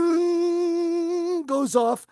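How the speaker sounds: slow, high sung doorbell bung; high, drawn-out doorbell ding; doorbell chime. Retold or imitated. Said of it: "you hear the world's loudest doorbell".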